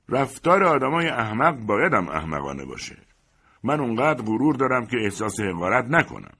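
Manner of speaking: indignant and self-important; haughty retort. Acted. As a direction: answer back with wounded pride. Ejected man haughtily answers back.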